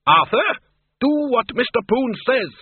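high-pitched, nagging scold; scolding Doreen. A narrator's reading. Doreen ordering Arthur to obey, high and scolding. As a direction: order him about in a high, bossy voice.

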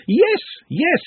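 very high-pitched squeal; excited scream. A narrator's reading. Pepe accepting Glenda's price, screaming. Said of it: "and screamed".

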